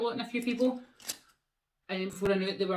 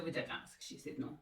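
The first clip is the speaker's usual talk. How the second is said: whispered aside; 15 dB quieter than usual. Real, not acted.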